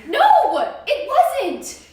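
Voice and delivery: loud high indignant protest; shouting protest. Acted. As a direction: yell it in protest. Kid insists, parents doubt.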